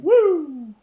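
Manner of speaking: high, loud drawn-out whoop; imitated wrestler's catchphrase holler; Ric Flair-style "woo". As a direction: let out a showman's ringing woo. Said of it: "And Flair's going".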